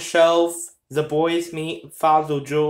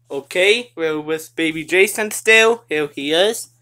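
ordinary talk, nothing marked

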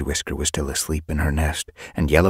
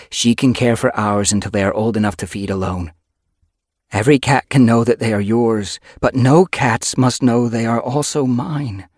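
slightly louder character voice; lightly voiced she-cat; 6 semitones higher, 6 dB louder than usual. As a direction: explain the plan firmly. Yellow Fang explaining.

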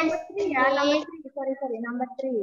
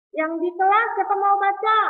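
calling out; 5 semitones higher, 6 dB louder, faster than usual. Real, not acted. Teacher calls out to class.

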